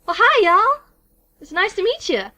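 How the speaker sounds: high-pitched southern welcome; southern accent. Acted. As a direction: greet newcomers in a lifted southern voice. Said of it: "I guess they're southern".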